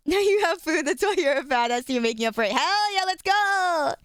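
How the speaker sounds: excited cheering. Real, not acted. Streamer hyping up a chatter who ate a lot, excited cheer. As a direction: pump them up, hell yeah.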